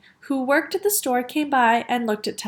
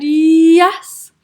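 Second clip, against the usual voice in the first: loud, stretched "yyyes"; escalating insistence; 4 semitones higher, 10 dB louder than usual. A narrator's reading. Cashier topping the father, insistent. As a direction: swell into it, louder and rising.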